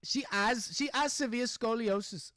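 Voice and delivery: high-pitched British accent attempt; failed British accent. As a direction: badly imitate a British woman. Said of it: "I can't do it".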